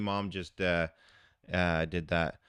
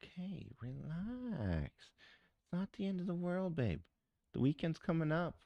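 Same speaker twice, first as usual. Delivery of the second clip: soft reassuring; 9 semitones higher, 8 dB quieter than usual. Real, not acted. Soothing someone off-stream.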